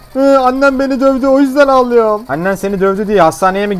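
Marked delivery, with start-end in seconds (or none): 0.1-2.2 put-on high, loud, whimpering kid voice; whiny child patient. Child patient tells the doctor why he cries, high whine. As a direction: whine like a beaten little kid.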